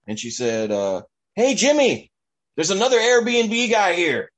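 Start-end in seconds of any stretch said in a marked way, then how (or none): 1.4-4.3 high, loud call into the house; retold yell. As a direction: call back inside to her son, much higher and louder. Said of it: "she kinda yelled back in there".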